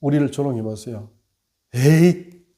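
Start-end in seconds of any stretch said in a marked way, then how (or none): 1.7-2.5 a short, loud mock cry of anger; retold flare of temper; angry outburst. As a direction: snap out a frustrated "에이!". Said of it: "이러면서 칼을 벌컥 내면서".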